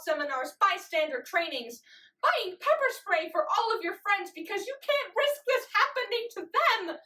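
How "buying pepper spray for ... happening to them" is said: higher, intense rush of worry; urgent climax. Acted. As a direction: build to an urgent, raised-pitch peak. Urgent climax of listed precautions.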